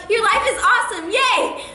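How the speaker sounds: high, loud, bubbly squeal of praise; over-the-top cheerful compliment; gushing excitement. Retold or imitated. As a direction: gush like an overexcited pop star friend. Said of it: "And she'll be like".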